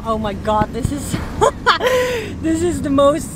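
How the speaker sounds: usual speaking voice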